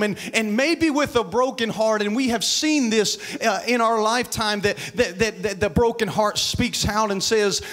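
preaching that climbs with punched words; building sermon. Acted. Preacher pushing the congregation through grief and regret, building sermon. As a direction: press the congregation harder, line by line.

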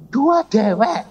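high-pitched brush-off; brusque refusal. Retold or imitated. Husband brushing off his wife's prayer request, brusque.

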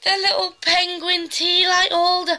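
high, slow gushing over cuteness; excited squeal. Real, not acted. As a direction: squeal at something adorable. Unboxing a cute gift.

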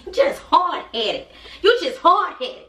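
laughing call-out of a hard-headed viewer; laughing scold. Real, not acted. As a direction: scold them while cracking up.

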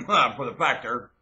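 ordinary delivery

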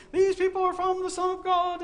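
put-on high, loud, strange calling voice of a possessed girl; shrill the slave girl's cry from the street. Preacher voicing the demon-possessed girl crying out after Paul, high and loud. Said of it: "walking around saying".

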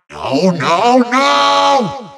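rising to a high, wailed last word; dismayed outcry. Acted. Villain loses the fire source, rising wail of defeat. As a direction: build from protest to a wail.

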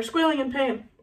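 ordinary delivery, nothing marked

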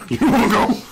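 high-pitched jokey fight challenge; mock bro challenge. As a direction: play at picking a fight, voice jumping up through laughter.

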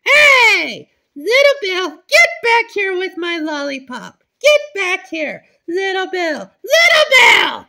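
loud chasing yells; angry shouting. Pretend. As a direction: shout after him as he runs. Chasing lollipop thief.

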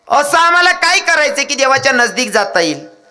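high-pitched pleading question; disciples' eager question. Acted. Disciples ask the saint how to reach God, high calling question. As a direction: call the question out high, easing down at the end.

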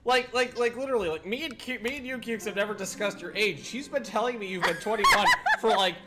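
high, loud incredulous laughing rant; excited indignation. Real, not acted. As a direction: protest in mock outrage, laughing.

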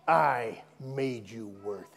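low, slow, deliberate rebuke; upset Jesus. Retold or imitated. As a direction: quote a stern correction, pitched well down and drawn out. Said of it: "Jesus was upset".

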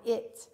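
"it" with a short I; short I sound. Shown as a demonstration. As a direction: sound out "it" as a schwa ending. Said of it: "the short I".

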